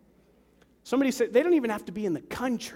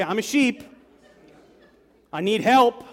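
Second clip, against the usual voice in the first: louder, rising call for the crowd to repeat; call for response; 3 semitones higher, 8 dB louder than usual. Shown as a demonstration. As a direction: lead the congregation to echo it. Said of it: "Somebody say".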